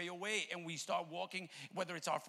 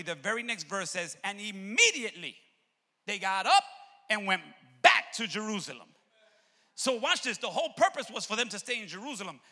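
loud, raised, driving sermon delivery; fervent preacher's shout; full-force preaching; 6 semitones higher, 12 dB louder than usual. Real, not acted. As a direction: preach it hard to the whole church.

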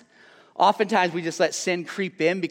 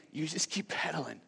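far softer, hushed "keep" leaned on; hushed Jesus; 3 semitones lower, 13 dB quieter than usual. Acted. Jesus to the struggling preacher, hushed and much softer. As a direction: whisper "You just keep pedaling" like a private promise. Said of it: "And Jesus is saying".

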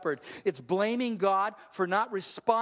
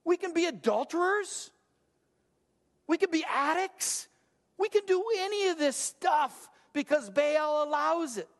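higher, carefree voice of people who want Baal; a hint of a smug sinner bragging; mock carefree idolater; 7 semitones higher than usual. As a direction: lightly play the ones who want to do what they like.

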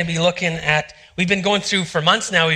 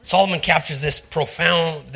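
ordinary delivery

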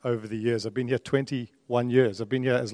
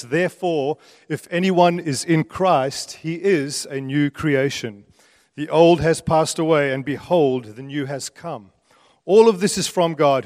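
louder, punchy scripture preaching; emphatic preaching; 6 dB louder than usual. Real, not acted. Preacher declares new creation in Christ to congregation, emphatic preaching. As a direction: proclaim it to the room with weight on key words.

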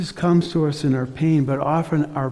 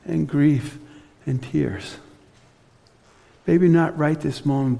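normal talking voice

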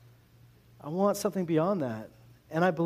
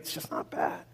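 whispered shrug; 12 semitones lower, 8 dB quieter than usual. Retold or imitated. Deflated mock answer.

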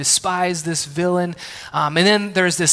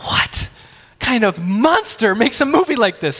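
higher, louder incredulous question; mock outrage; 5 semitones higher, 3 dB louder than usual. Dad reacts to the sad children's movie with mock outrage. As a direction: play up the outrage.

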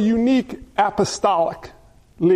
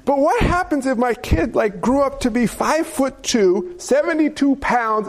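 ordinary talk

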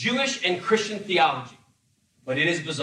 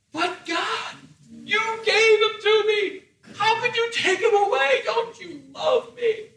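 high, whining mock plea; hammed-up pleading; 13 semitones higher, slower than usual. Abraham pleads with God, hammed-up high whine. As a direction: beg like an overacting martyr.

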